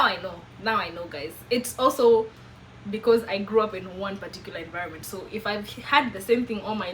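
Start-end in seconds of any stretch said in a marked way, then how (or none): none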